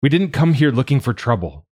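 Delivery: high, loud plea with didn't stressed; urgent plea. Acted. Narrator calming armed gang, loud urgent plea. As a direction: insist hard, "didn't" pressed up high. Pleading amid cocked rifles.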